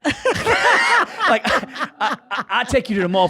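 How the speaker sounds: high, loud words breaking into laughter; laughing while talking. Real, not acted. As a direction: crack up mid-sentence. Cracks up retelling his backpedaling offer.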